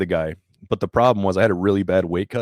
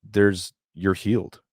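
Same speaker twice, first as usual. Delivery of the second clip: slow reassurance; slower than usual. Retold or imitated. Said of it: "but he's like".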